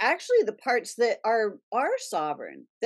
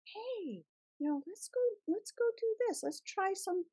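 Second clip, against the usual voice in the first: high, soft little voice, eager and coaxing; a small playful voice tugging you along; tiny inner voice; 10 semitones higher, 9 dB quieter than usual. Retold or imitated. The little inner voice coaxing the gardener, high and soft. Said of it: "That little inner voice would be like".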